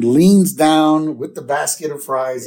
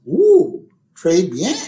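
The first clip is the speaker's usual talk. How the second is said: mock-French, high swooping "ooh"; put-on French flourish, pitched up; Marge; 6 semitones higher, 5 dB louder, much slower than usual. Marge going all French at dinner, mock-French coo. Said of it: "Marge decides to go all French".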